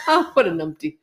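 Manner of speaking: laughing through the words. Real, not acted. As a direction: giggle the word out. Repeating with a laugh.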